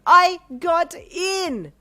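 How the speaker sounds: high, loud, slow triumphant yell. A narrator's reading. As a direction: shout each word out in triumph. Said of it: "you yelled".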